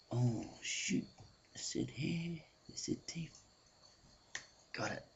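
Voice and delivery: very soft, hushed muttering to himself; worried mutter. Acted. Irish bloke panicking quietly before his turn, hushed. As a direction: panic under his breath. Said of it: "the Irish bloke".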